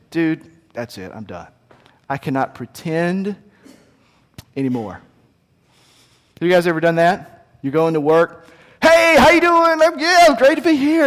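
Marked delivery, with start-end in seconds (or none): 0.1-5.0 worn out. Retold or imitated. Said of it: "he says". 8.8-11.1 much higher, faked chirpy greeting; forced cheerful. Fake cheer at work.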